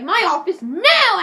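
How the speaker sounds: high, loud, drawn-out command; hammed-up yell; retold principal's order. Principal sends Eric to his office, loud mock yell.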